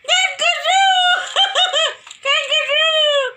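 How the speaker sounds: high shout breaking into laughter; laughing shout. Real, not acted. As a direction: yell it through giggles. Child shouts then laughs.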